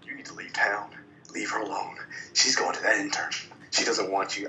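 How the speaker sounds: low, soft, unvoiced father's warning; a hushed threat from the father; stern father. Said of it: "his father comes up".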